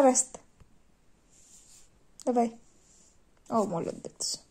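ordinary talk, nothing marked

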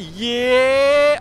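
high, loud victory yell; a real gamer's hype scream; triumphant shout. Real, not acted. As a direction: belt it out like you just won. Landing a hit mid-fight.

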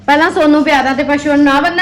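high, loud telling off; loud scolding. Real, not acted. Mother scolds child loudly.

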